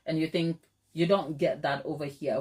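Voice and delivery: ordinary talk, nothing marked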